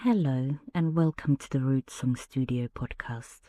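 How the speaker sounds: ordinary delivery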